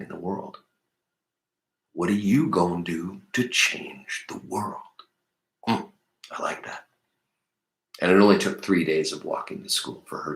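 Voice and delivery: low, soft thinking-aloud voice with long pauses; hushed, low, thoughtful commentary; quiet reflective musing. Real, not acted. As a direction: ponder it softly, letting each thought settle. Reader muses after the story.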